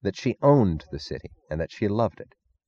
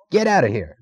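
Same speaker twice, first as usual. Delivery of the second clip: higher-pitched angry order; snarl; 6 semitones higher than usual. A narrator's reading. Cuffy Meggs ordering Stadler out, snarling. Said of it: "snarled Cuffy Meggs".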